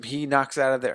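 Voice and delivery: ordinary talk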